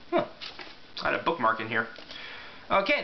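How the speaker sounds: ordinary talk, nothing marked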